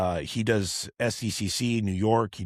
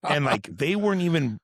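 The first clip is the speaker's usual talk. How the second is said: usual speaking voice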